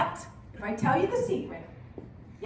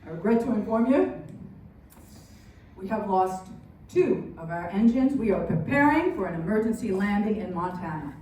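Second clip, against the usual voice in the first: low, measured pilot announcement; captain on the intercom; 9 semitones lower than usual. Acted. Captain telling passengers of engine trouble, low announcement voice. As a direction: deliver it as a grave, low-pitched captain. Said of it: "this is your captain speaking".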